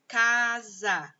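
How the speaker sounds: "casa" drawn out as ca, za; syllable by syllable. Shown as a demonstration. Teacher forming a word from syllables, "casa" said syllable by syllable. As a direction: join the two syllables of "casa" slowly. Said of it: "a sílaba K com a sílaba za".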